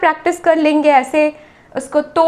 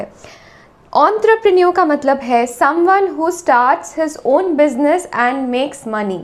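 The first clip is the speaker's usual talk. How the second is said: slow; much slower than usual. Shown as a demonstration. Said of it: "teacher reads definition slowly".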